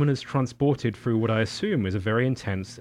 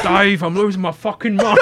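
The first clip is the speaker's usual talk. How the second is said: put-on loud, high, laughing Cockney accent; Cockney Hal; 10 semitones higher, 12 dB louder than usual. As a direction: wail it in a hammed-up Cockney accent. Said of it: "this Cockney Hal".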